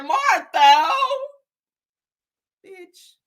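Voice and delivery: sneering call across the room; mocking jab at a rival. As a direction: toss the insult over the shoulder. Mocking woman calling out.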